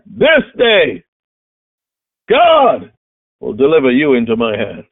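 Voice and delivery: fiery preaching. Acted. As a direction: thunder the threats from the pulpit. Preaching David's threat at full force.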